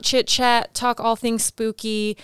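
ordinary delivery

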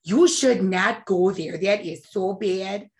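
mother's voice, drawn-out broad vowels; Jackie. Acted. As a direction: slowly scold like a Midwestern mom. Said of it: "my inner dialogue is her voice".

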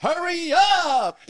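high, loud, stretched-out mock yell; wildly overacted cry, dragged out slowly; Bad Actor. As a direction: belt it like a hopeless ham actor. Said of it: "you'd hit the wrong syllable every time".

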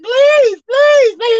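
high, loud pleading please; mock pleading. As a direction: beg like a child, then drop it. Mock begging the sea.

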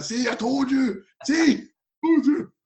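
high, slow mocking told-you-so; high sing-song I-told-you-so; gloating coach. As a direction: rub it in like a smug coach. Coach gloating after the header.

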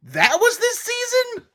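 shocked disbelief voice. Shown as a demonstration. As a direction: stretch it out high, stunned. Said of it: "do 'em really quick".

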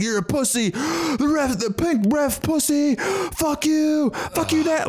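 high, strained yelling without letup; mock an out-of-control fan's tirade; imitated full-voice heckling. Imitating the nonstop fan abusing the ref, full-voice heckle. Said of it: "Full voice".

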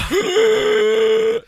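huge honking high snore; loud snore imitation. Imitating the mother snoring in the living room, loud honking snore. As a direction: blast out a cartoonish snore. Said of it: "That's what it sounds like".

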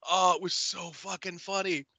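ordinary delivery

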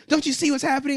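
shrill it like a panicked activist; mock alarm. Mimicking critics in high, hammed-up alarm.

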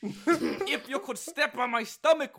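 high, slow, strained Scottish voice; squeezed mock Scottish voice. Host parodying the strained Scottish take, mock Scottish accent. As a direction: sound like someone's stepping on your stomach. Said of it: "someone's stepping on your stomach".

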